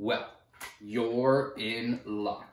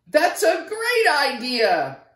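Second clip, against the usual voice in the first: much higher, louder, slow squeal of delight; shrieked; 12 semitones higher, 8 dB louder than usual. Acted. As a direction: squeal it with joy. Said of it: "shrieked Nebraska".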